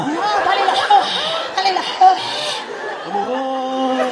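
lightly voiced entranced teacher; hungry teacher. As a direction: sound entranced by the smell.

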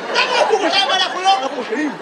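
put-on whiny kids protest.

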